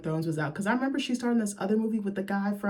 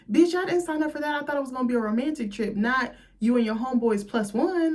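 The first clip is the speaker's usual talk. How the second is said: higher-pitched mock complaint; indignant protest; 6 semitones higher than usual. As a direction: play an offended girlfriend, pitched up. Acting out the girlfriend's protest.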